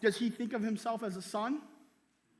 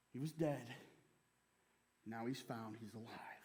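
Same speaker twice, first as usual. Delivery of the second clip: fading to a whisper; 12 semitones lower, 16 dB quieter than usual. Acted. Preacher voicing the father about the lost son, fading to a whisper. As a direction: drop from soft to a breathless whisper.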